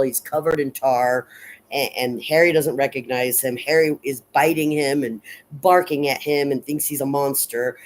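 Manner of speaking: ordinary talk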